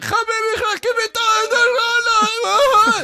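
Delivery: very high, loud, dragged-out crying shout; mock wailing plea. Other prisoner begging in agony, hammed-up wailing. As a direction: wail it like a desperate prisoner. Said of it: "the other guy's like".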